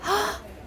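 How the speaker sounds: sudden high gasp of being moved; emotional gasp. Real, not acted. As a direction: catch your breath, overwhelmed.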